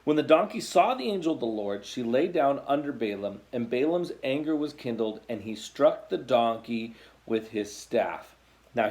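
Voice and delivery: ordinary talk, nothing marked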